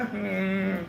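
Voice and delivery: mock reluctant child, mouth busy chewing; reluctant chewing kid. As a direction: whine like a kid made to finish a meal. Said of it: "like a kid that had to chew his meal".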